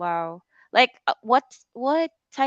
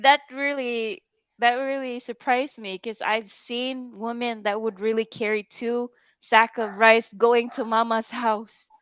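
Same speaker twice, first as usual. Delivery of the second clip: usual speaking voice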